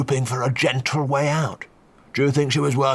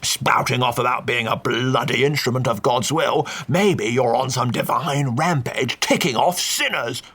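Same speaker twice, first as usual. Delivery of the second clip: put-on slow, sneering old Duke; mocking counter-accusation; 3 semitones higher, slower than usual. A narrator's reading. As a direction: turn the blame on the priest.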